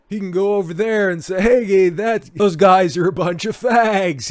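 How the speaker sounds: high, loud mock heckling; mock shout. Host imagining the actor over in Ukraine, hammed-up shout. Mocking what actor might say abroad.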